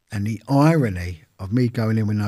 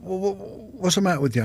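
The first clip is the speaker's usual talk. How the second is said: high, stuttering mock drill sergeant; lightly voiced sergeant barking at a recruit who cannot speak; sergeant's stammered demand; 10 semitones higher than usual. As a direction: throw it at the recruit, incredulous. Sergeant confronts mute recruit.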